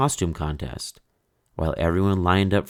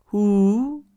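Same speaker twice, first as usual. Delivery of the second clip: ghost hoot; 6 dB louder than usual. Acted. Ghost disguising itself.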